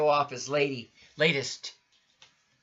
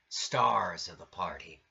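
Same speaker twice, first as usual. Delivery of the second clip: plain reading voice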